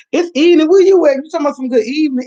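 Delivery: teasing a viewer who says good evening at dawn, mock outrage. Real, not acted. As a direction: call out the wrong greeting, half joking. Teasing viewer's wrong greeting.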